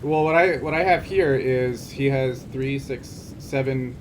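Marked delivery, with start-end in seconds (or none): none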